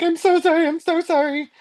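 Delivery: high-pitched mock-distressed apology; retold frantic apology. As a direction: plead sorry in a comic panic. Retold frantic apology on returning car.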